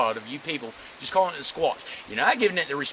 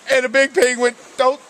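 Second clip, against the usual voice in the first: hammed-up panicked yell; 13 semitones higher, 7 dB louder than usual. Traumatized hunter yells at memory.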